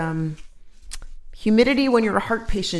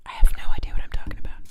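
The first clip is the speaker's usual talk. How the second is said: fast whisper; much faster than usual. Real, not acted.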